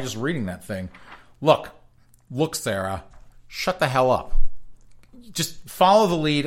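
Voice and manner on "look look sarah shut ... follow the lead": scolding. Acted. Telling Sarah off.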